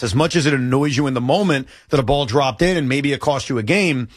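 usual speaking voice

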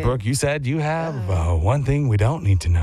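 Chris Hansen voice. Retold or imitated. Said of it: "I, you're doing okay".

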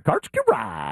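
high-pitched, drawn-out call of Karch Kirai; mock play-by-play call. Announcer recalls calling his put-away, high drawn-out mock call. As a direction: call out the name as if he just put the ball away. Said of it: "That's how I used to say it when he would get it".

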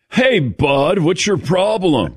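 stern teacher line, a little slow and lightly voiced; Mister Hand impression. Quoting Mister Hand from a movie, a lightly voiced stern scold. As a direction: lightly mimic a grumpy high-school teacher. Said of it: "Mister Hand in Fast Times at Ridgemont High".

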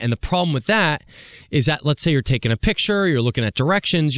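normal talking voice

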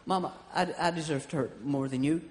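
soft, low, mock-sincere child; mock earnest kid. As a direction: offer it like a saintly child. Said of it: "what she would have said if".

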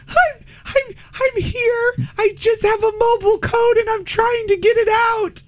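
very high voice strangled by laughter; reenacted helpless giggling; giddy. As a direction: squeak it out while trying not to laugh. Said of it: "why you sound so giddy".

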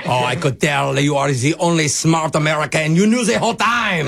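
put-on French accent, rising in pitch; cartoon French flattery. Prank caller admitting defeat to pharmacist, in a French accent.

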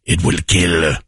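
put-on a father declaring his demon child's fate, low and ominous; ominous deep voice. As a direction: intone it like a doom prophet.

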